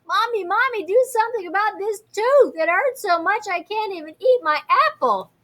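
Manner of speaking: put-on high, loud whiny kid yell about a sore tooth; yelling child. A narrator's reading. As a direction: wail it like a child in pain to mommy. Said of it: "and yelled".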